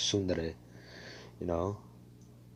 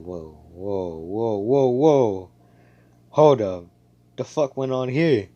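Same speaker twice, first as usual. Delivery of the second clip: backing off in shock, getting louder; startled disbelief; 7 dB louder, slower than usual. Retold or imitated. Asta reacting to smiling Noelle, loud startled disbelief.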